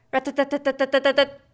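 fast rat-tat like a little hammer; woodpecker tapping. A narrator's reading. Reading to a child, a quick woodpecker rat-tat. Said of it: "sounds like a little hammer".

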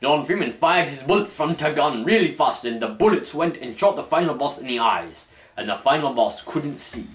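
ordinary delivery

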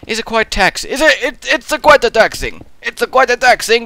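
high, loud hammed-up Italian accent; mock Italian accent. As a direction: ham up a fake Italian accent. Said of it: "saying it in an Italiano accent".

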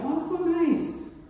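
high, slow, sugary "Oh, so nice"; mock sweet gush. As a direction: gush over the free breakfast. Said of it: "And I'm like".

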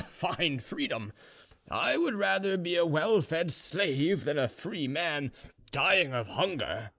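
put-on slow, high-pitched drunken indignation; drunk Ivan. A narrator's reading. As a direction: scoff tipsily, drawing words out high. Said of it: "getting more and more drunk".

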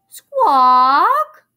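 high, loud squawking bird cry; parrot squawk. A narrator's reading. The parrot crying out to Jack and Annie, a loud squawk. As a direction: screech it like a parrot.

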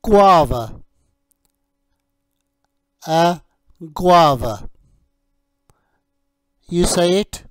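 slow, clear teacher voice modelling each word; teacher drilling a young English learner on fruit names; vocabulary drill. Shown as a demonstration. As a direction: model each fruit name for a child to repeat.

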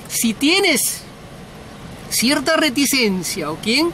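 ordinary talk

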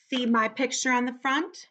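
usual speaking voice

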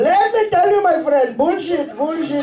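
a high, bouncy imitation of an Indian accent; mock Indian accent. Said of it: "dating an Indian guy".

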